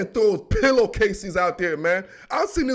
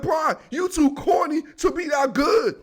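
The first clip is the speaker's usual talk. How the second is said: higher, mocking hater voice; jealous Will Smith; 7 semitones higher than usual. Will Smith teasing Carlton, jealous and mocking. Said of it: "He's like".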